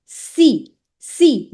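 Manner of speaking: "C" said as a high, clear SEE; CI sound. Shown as a demonstration. Teacher repeats CI sound for students, clear high SEE. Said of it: "C I gives a sound of C".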